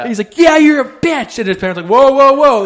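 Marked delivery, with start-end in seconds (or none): 0.4-1.4 high, slow, loud taunt; imitated mad kid. Mad boy insults his sister, loud mock shout. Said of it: "he was mad because his sister". 1.9-2.6 high, loud rapid interjections; mock alarmed parents. Parents stop their swearing son, high mock alarm.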